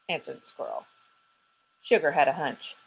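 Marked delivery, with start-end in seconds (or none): none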